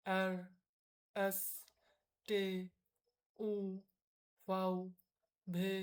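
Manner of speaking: German letters said slowly, one by one, for the class to repeat; letter names with long pauses to repeat; repeat-after-me drill. Shown as a demonstration. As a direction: lead the class through the alphabet. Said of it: "আপনারা আমার সাথে সাথে বলবেন".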